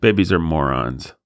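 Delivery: normal talking voice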